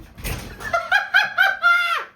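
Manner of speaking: very high shrieking laugh; real burst of shrieking laughter; screaming laugh. Real, not acted. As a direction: let out a shrill whooping laugh. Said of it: "I'm not disturbing anyone's sleep by screaming".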